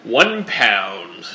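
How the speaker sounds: slow, drawn-out mock announcer; practicing TV voice. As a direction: stretch it out like an anchor warming up. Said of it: "practicing their T V voice".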